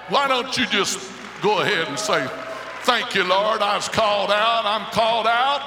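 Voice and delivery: loud, high exhortation; preaching at full force. Acted. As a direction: urge them to praise out loud. Preacher urging the congregation.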